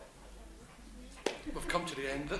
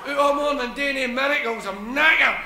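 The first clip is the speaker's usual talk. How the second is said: louder, exhausted grumble; weary complaining; 4 semitones higher, 8 dB louder than usual. Acted. Jesus moaning to the apostles about miracles, weary. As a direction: moan about a long day. Tired figure complaining loudly.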